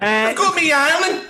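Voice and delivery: high, loud mock stubborn mum; mum refusing. Mum refuses on the phone, high and stubborn.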